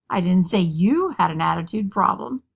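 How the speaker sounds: strong emphasis on "you", as if someone else were meant; stress on YOU. Shown as a demonstration. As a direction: punch the word "you" mid-sentence. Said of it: "just by emphasizing a different word".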